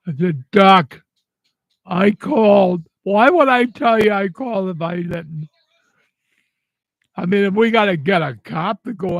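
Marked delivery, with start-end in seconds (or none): 0.5-5.5 retold outburst, much louder, hammering each word; angry shout. Widower shouting at the doctor who denies getting his message, angry and loud. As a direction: shout him down, insisting you called.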